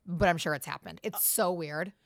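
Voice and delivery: normal talking voice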